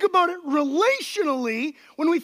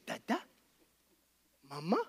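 put-on soft coaxing baby talk; 12 semitones lower, 9 dB quieter than usual.

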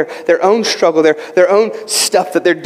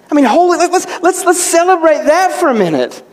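excited celebration; 9 semitones higher than usual. Real, not acted. Celebrating the lost son found.

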